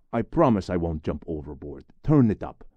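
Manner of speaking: insistent. Acted. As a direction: push him to crank the music. Pleads to turn up song.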